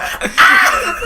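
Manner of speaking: high, loud scream with laughter in it; comic high-pitched shriek; mock scream. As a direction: shriek it, cracking up. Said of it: "She screams like".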